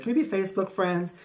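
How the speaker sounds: high, quick chirpy question; bright friendly ask. Retold or imitated. Recruiting people, a high quick friendly ask. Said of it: "I said".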